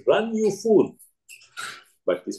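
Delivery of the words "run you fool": shouting. Retold or imitated. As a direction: yell the warning in mock alarm. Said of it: "shouting at the top of his voice".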